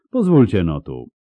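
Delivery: ringing but restrained hail; resonant call. A narrator's reading. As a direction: beckon them over with a carrying voice. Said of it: "rozległ się nagle dźwięczny głos".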